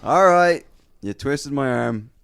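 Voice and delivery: loud, high, stretched-out surrender; mock reluctant giving-in. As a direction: give in with showy fake reluctance. Giving in to the crowd.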